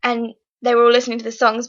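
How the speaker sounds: ordinary talk, nothing marked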